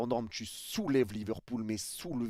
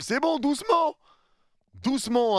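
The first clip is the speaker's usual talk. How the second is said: mock calming; 13 semitones higher, 5 dB louder than usual. Mock-talks to flying keeper.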